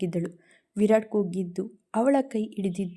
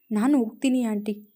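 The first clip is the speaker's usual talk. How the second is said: ordinary delivery, nothing marked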